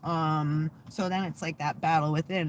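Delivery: normal talking voice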